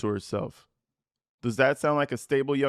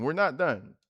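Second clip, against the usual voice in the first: ordinary talk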